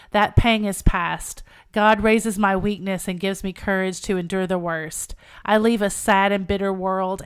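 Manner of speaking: loud, resolute condemned woman's speech; fervent novel narration; dramatic story reading. Acted. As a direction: declaim it firmly, easing off toward the end.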